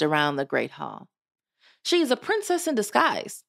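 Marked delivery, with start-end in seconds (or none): 1.8-3.4 high gossiping voice; gossiping guest. Acted. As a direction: whisper it like court gossip.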